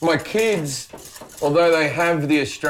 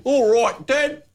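high-pitched kid mimicking an Australian accent; mock Australian accent; 11 semitones higher, much slower than usual. Son impersonating his Australian dad, high mock Australian accent. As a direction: badly imitate Dad's Australian accent, pitched up. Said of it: "he does the impersonation".

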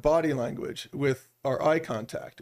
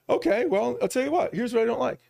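opening up; 8 semitones higher than usual. Retold or imitated. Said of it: "they'd be like".

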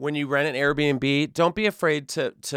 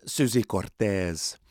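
put-on lower, slow Brazilian Portuguese accent on Suzy Cortez; mock Brazilian accent; 6 semitones lower, 3 dB quieter than usual. Reading the news story, the name in a Brazilian accent. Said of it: "That was a good accent".

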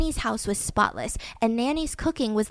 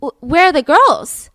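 loud, slow call of a worried father; father searching the house, calling out loud and slow; alarmed call; 4 semitones higher, 9 dB louder, slower than usual. Retold or imitated. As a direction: call out slowly for the missing girls. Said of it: "And he says".

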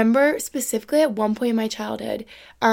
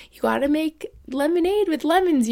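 lightly voiced cheerful dad, pitched higher; a hint of a chipper father; playful dad cheering up the family; upbeat dad; 6 semitones higher than usual. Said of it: "he was like".

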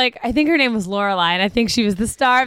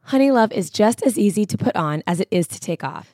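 ad read; 4 semitones lower than usual. Acted. Host reads sponsor ad.

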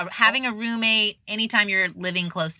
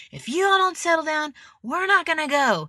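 high, firm parent warning; stern dad warning; 10 semitones higher than usual. Retold or imitated. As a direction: scold the kids like a fed-up dad. Dad scolding jumping girls.

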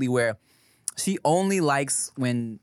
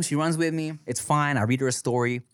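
ordinary talk

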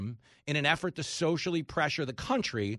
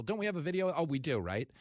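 ordinary talk

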